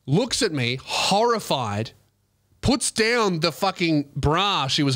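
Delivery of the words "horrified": ordinary talk, nothing marked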